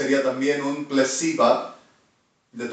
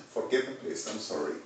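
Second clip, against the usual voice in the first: ordinary delivery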